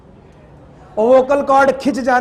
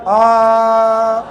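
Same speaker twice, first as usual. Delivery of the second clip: long, steady, loud-ish open vowel; sustained yelled "aah"; 4 dB louder than usual. Shown as a demonstration. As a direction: hold one "aah" on a level note. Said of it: "अरे कितनी देर चिल्लाए हम".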